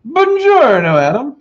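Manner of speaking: put-on high, loud hammy Italian accent. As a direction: greet like a cartoon Italian. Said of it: "in Italian accent".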